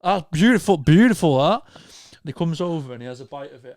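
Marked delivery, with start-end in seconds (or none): 0.0-1.6 put-on drunk rapture. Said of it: "And you're like".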